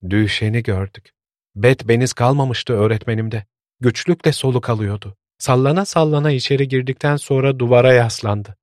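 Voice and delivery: ordinary delivery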